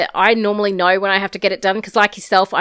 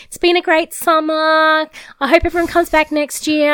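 camp counsellors' farewell; 6 semitones higher than usual. Retold or imitated. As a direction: mimic gushing camp counsellors. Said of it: "all of the counsellors saying".

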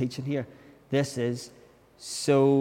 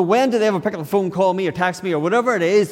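higher and louder, fast exasperated complaint; frustrated gripe; 5 semitones higher, 5 dB louder, faster than usual. Retold or imitated. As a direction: rattle off the grievances. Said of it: "it'll say things like".

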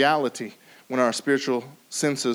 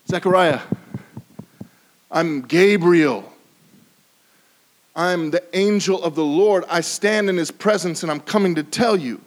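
angel's declaration; 3 semitones higher, 4 dB louder than usual. Acted. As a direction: declare your name with authority. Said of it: "Gabriel is making a statement".

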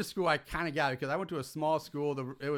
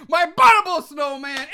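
much louder and higher, booming; big announcer shout; 12 semitones higher, 15 dB louder than usual. Acted. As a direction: proclaim the title like a showman. Host announcing guest title.

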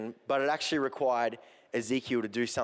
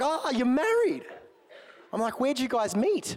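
much higher, louder, slow gushing; mock excitement; 12 semitones higher, 3 dB louder than usual. [name] teasing his own eager reaction to married Peter, high and gushing. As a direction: gush in an over-the-top delighted voice.

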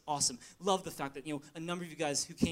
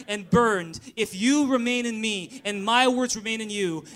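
slow, loud congregation reading; loud group reading; 3 semitones higher, 8 dB louder than usual. Real, not acted. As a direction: boom it out with the whole church. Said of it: "read in a loud voice with me".

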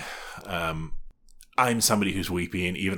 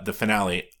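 usual speaking voice

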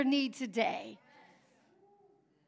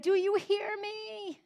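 high, drawn-out plea; pained cry; 7 semitones higher, 3 dB quieter, slower than usual. Acted. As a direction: cry out in pain, stretching the words. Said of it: "there's also this pain of".

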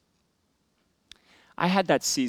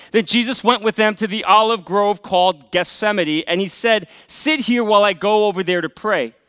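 loud, high scripture reading from the pulpit; preaching at full force; 6 semitones higher, 9 dB louder than usual. Acted. Pastor reading Gethsemane to the church, in full preaching voice. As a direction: proclaim the passage to the congregation.

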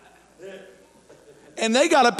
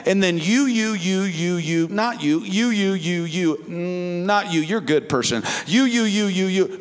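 mock selecting; 5 semitones lower than usual. Mimics Peter picking out people selectively.

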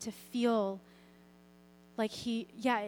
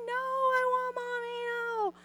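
imitating a screaming toddler; 12 semitones higher than usual. Mom retells her daughter refusing bed, high mock toddler scream. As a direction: mimic the little girl's wail, pitched way up. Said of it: "She's still screaming".

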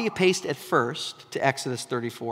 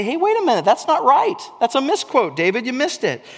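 much higher, loud startled protest; surprised objection; 8 semitones higher, 5 dB louder than usual. Pretend. Reader catching David's misquote, loudly startled. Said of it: "you stop and you say".

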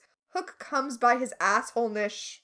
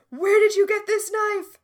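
higher, louder retold outburst; mock freak-out; 8 semitones higher, 7 dB louder than usual. Podcaster acting Hook confronting Nemo, mock freak-out. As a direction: blurt it out as a hammed-up freak-out. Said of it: "Hook starts freaking out and is like".

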